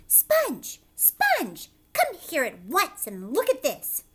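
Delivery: high, loud shrill call across the garden; shrieking aunt calling. Acted. Aunt Spiker yelling for her sister to come look. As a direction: screech her name to come at once.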